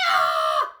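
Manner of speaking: loud unvoiced panic shout; panicked scream. Acting squirrel panic.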